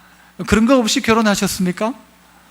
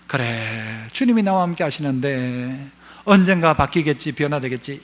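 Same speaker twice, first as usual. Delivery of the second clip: soft, much lower voice of patient hope; quiet trust; 8 semitones lower, 6 dB quieter than usual. Acted. Pastor voicing a believer's quiet trust. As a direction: settle into gentle faith.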